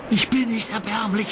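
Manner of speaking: high, indignant protest; Thomas scolding. A narrator's reading. Thomas scolds Percy, high and indignant. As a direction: snap back offended. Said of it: "schimpfte Thomas".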